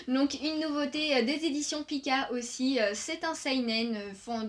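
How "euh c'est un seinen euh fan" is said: ordinary delivery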